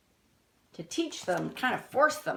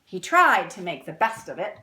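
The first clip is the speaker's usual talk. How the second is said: storybook reading; 2 semitones higher, 7 dB louder than usual. A narrator's reading. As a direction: read it like a picture book to kids. Reads the book text aloud.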